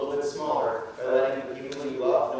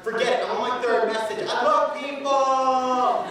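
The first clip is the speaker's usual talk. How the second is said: higher, louder, laughing at the end; eager student; 7 semitones higher, 4 dB louder than usual. Retold or imitated. Keen student bragging to the room, higher and laughing. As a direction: brag happily like you're way ahead. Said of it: "You're just like".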